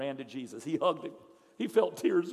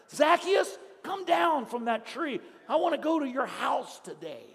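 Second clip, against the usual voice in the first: calling out; 7 semitones higher, 6 dB louder than usual. Acted. Jesus calls up the tree.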